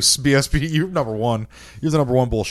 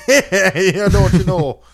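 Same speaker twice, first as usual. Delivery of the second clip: put-on high, loud, laughing Jamaican accent; hammed-up Jamaican lilt with a laugh; mock Jamaican accent; 10 semitones higher, 8 dB louder, much slower than usual. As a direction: joke around in a badly faked Jamaican accent. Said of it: "it always turns into Jamaican".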